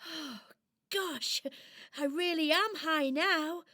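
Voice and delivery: high doll voice thinking aloud; marvel, then wonder if you'll make it; wondering little doll. Acted. Doll climbs and looks out.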